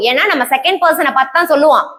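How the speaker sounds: ordinary delivery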